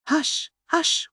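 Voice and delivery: plain reading voice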